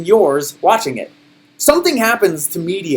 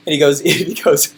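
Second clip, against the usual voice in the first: normal talking voice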